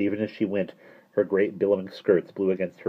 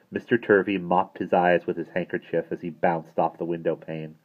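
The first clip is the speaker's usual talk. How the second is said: ordinary delivery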